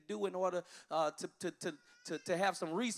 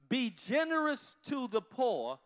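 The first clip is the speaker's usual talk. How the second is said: reading aloud; 5 semitones higher, 4 dB louder, slower than usual. Shown as a demonstration. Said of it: "Let's read it together".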